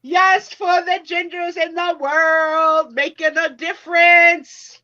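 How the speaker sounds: high, slow cheer for the gingers; triumphant. Real, not acted. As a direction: celebrate the win.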